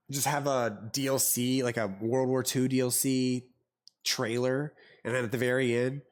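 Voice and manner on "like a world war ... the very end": normal talking voice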